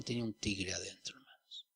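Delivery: hushed whisper to the congregation; awed preacher's whisper; whispered awe. Acted. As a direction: breathe it out like a secret. Awe at the Spirit's power.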